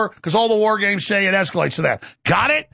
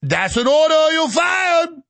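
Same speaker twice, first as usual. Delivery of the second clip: raised-pitch hammed-up Cajun boss; mock bossy order; 8 semitones higher, slower than usual. Executive firing engineer, hammed-up anger. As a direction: fire them in a high, put-on boss voice.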